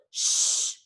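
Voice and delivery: unvoiced shush; hushing. A narrator's reading. Charlie silencing Benjamin to hear voices, sharp shush. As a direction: hush him at once.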